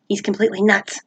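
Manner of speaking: ordinary delivery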